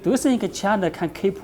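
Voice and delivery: ordinary talk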